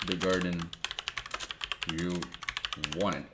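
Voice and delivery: ordinary talk, nothing marked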